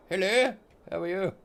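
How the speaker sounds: sing-song hello to a ptarmigan; talking to a bird. Acted. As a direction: chirp a hello at the little bird. Greeting a bird.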